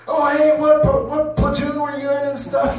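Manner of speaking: high, slow, eager gym bro voice; a wide-eyed guy asking about your platoon; mock eager veteran in the gym.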